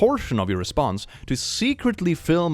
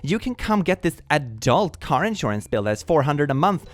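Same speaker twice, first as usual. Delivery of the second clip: high, snippy offended mother; petty mom voice; 5 semitones higher than usual. Retold or imitated. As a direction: snap back like a spiteful mom. Mom's petty retort.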